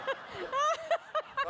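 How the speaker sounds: high squealing laugh at the memory; real high laugh breaking out; burst of laughter. Real, not acted. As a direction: laugh out loud, caught off guard. Recalling absurd advice.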